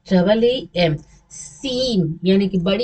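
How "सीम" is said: सीम with the ee drawn out; stretched long ee. Shown as a demonstration. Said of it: "बड़ी को तो हम लोग खींचते हैं".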